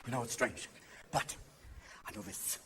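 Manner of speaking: low, very soft near-whisper; mock-serious hush, pitched far down; hushed confiding. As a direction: confide it under your breath.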